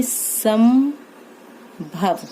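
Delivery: संभव stretched very slowly, syllable by syllable; slow dictation of संभव for the class; drawn out while writing. Shown as a demonstration. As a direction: say संभव at the pace of the pen stroke. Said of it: "तो किस तरह से बनाएंगे देखिए".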